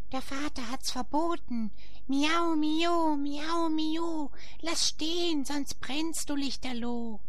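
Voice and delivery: very high, thin warning cat voice. A narrator's reading. The cats warning Paulinchen, high cat voice. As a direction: raise the pitch way up and wag a paw. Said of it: "Sie drohen mit den Pfoten".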